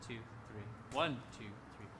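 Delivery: soft lilting one two three count; counted waltz beats. Shown as a demonstration. Conductor shows the waltz feel to players, lilting. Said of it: "starting to feel like more of a waltz".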